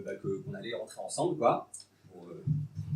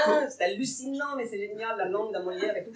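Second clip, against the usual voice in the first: a lightly voiced, higher Luchini; quick retold Luchini gush; Luchini impression; 4 semitones higher, 6 dB quieter than usual. Telling a party story, redoing his Luchini voice. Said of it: "je fais mon imitation de Lucchini".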